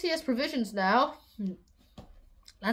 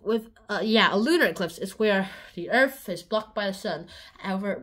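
ordinary talk

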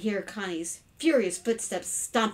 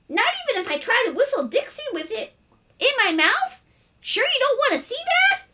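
put-on very high boyish wheedling; eager pestering; 5 dB louder than usual. A narrator's reading. Boy still tempting his unwilling father, eager and squeaky. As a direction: keep pushing, pitch way up. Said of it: "eagerly at his father".

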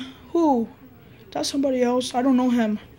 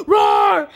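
high-pitched shouted roar; shrieky pretend monster roar; scary monster roar; 8 semitones higher, 4 dB louder than usual. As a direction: roar to scare them. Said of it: "I'm finna scare them now".

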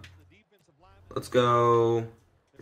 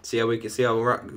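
ordinary talk, nothing marked

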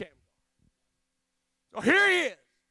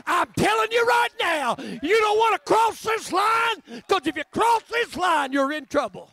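defiant warning; 8 semitones higher, 11 dB louder than usual. Acted. Farmer warns the invading army.

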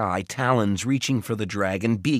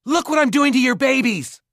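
loud, high defiant shriek; desperate taunting scream; 7 dB louder than usual. A narrator's reading. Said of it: "he screamed".

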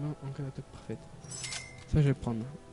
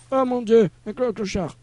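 surprised; 8 semitones higher, 9 dB louder than usual. Real, not acted. Startled by a tramp in game.

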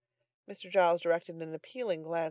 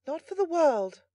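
high, emphatic female refusal; young lady; 11 semitones higher, 6 dB louder than usual. A narrator's reading. Young lady refusing to look at the captive, high emphatic tone. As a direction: refuse firmly in a light voice. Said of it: "replied the young lady".

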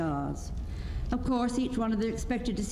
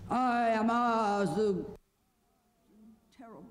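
faltering wizard proclamation; 3 semitones higher than usual. A narrator's reading. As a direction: begin grandly, then break off and finish small. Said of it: "said the little man".

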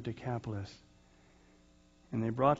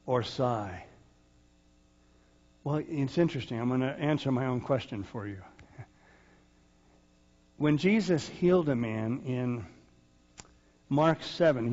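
ordinary delivery

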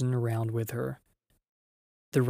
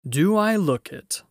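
great lion voice; 7 semitones higher, 6 dB louder than usual. A narrator's reading. Aslan teasing the frightened girls.

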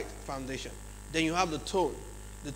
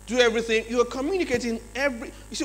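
ordinary talk

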